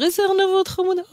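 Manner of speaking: high cooing "how cute" voice; cooing passerby. Retold or imitated. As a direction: coo it like a charmed passerby. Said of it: "יוצא ואומר". (קול מתמוגג של עובר אורח)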